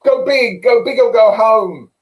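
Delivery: loud high booming motivational shout; quoting Grant booming. Retold or imitated. Speaker mimics Grant bursting into the room, loud hype shout. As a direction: belt it like a hyped-up American guru. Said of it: "he was".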